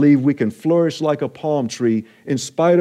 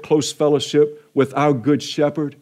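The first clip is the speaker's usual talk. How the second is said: usual speaking voice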